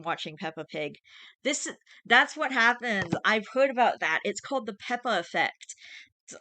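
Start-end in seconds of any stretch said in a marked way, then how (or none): 1.4-5.2 1 excited. Real, not acted. Excited agreement with comment.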